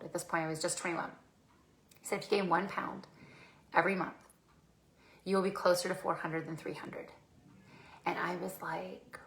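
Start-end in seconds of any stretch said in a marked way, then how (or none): 2.2-7.1 softer, with long weighty pauses; grave, deliberate warning. Retold or imitated. Trainer warns client about reaching four hundred, gravely deliberate. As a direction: let each phrase sink in quietly. Trainer repeats his grim projection.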